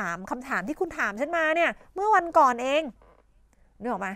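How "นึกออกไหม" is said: ordinary delivery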